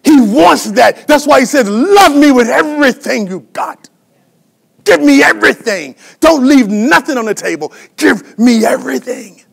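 high, loud, impassioned sermon shout; full-force preaching. Real, not acted. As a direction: belt it out to the congregation.

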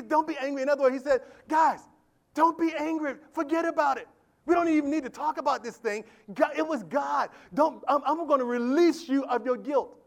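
loud, high, rousing sermon delivery; shouted, fervent preaching; full-force preaching. Acted. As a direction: preach it out to the whole church. Preaching Joseph's words.

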